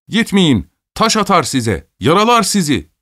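high, urgent cries of caution; warning. A narrator's reading. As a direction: call out to stop someone going. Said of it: "diye uyardı Simirov Alyosha'yı".

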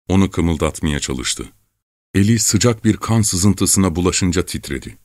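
usual speaking voice

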